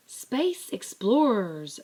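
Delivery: loud, slow shout; barked. A narrator's reading. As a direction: call the crew to order. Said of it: "barked Digger".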